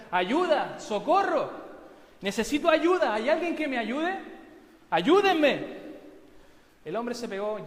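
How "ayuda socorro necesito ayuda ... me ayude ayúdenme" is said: loud, high desperate cries for help; shouting for help. Acted. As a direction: yell for help from the cliff. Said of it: "empezó a gritar". (gritos desesperados pidiendo ayuda)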